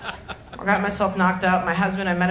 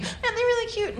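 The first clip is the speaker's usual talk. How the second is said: put-on much higher squealing girly voice; over-the-top cooing mom-to-be; gushing friend; 12 semitones higher, 4 dB quieter than usual. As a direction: squeal over tiny socks. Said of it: "friend quoted".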